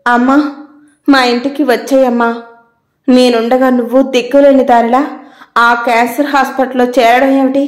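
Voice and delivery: usual speaking voice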